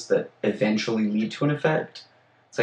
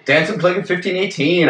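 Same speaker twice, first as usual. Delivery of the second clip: excited; 8 semitones higher than usual. Real, not acted. Excited echo of title.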